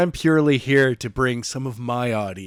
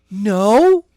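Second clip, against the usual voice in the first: a loud, higher, firm no; firm refusal; 8 semitones higher, 8 dB louder than usual. Acted. Jenna refusing Skylar, loud and firm. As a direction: shut him down in one word. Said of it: "said Jenna firmly".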